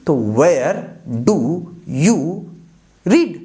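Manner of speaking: each word split off by a clear pause; teacher spelling out a question. Shown as a demonstration. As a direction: dictate it slowly, one word at a time. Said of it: "teacher dictates word by word".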